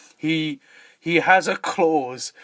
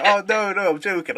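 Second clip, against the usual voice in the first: mock backpedalling; 5 semitones higher than usual. Acts out hastily backing off.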